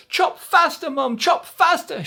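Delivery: high, loud frantic shout; high desperate shout. Acted. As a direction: yell at Mum to hurry. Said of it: "shouted Jack".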